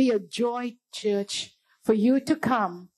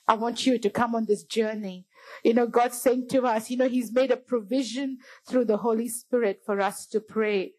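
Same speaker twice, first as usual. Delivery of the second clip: ordinary delivery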